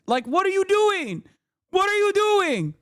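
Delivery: incredulous high-pitched scolding; high, exasperated outburst at an absent culprit; exasperated disbelief. Acted. Host berates agent in clip.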